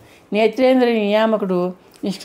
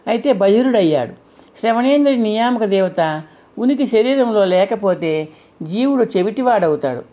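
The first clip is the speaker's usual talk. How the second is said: ordinary delivery, nothing marked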